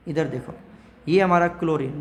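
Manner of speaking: usual speaking voice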